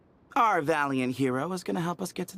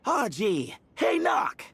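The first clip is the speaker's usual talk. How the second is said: playful shout; 8 semitones higher, much slower than usual. Pretend. Teasing his friend Jur with a punny shout. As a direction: shout the pun out, the second part much higher. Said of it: "just say".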